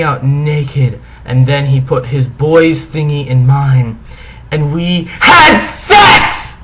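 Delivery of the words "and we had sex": slow, loud hammed-up announcement; mock shout. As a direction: shout it out slowly, mocking. Mocking the climax line.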